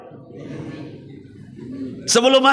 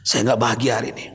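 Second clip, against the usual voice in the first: put-on lower, subdued voice of a tearful old man; mild tearful confession, lower pitch; weeping old man; 9 semitones lower, 4 dB quieter than usual. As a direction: voice the crying rich elder, held low. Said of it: "menangis beliau sambil berkata".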